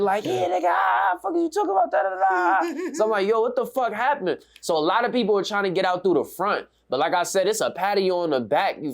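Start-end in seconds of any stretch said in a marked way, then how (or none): 0.2-2.9 very high-pitched mock hollering; strained high imitation of a raging holler; mimicked screaming. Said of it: "screaming and hollering and shit". 3.3-4.4 retold alarm. Acts out his alarmed reaction.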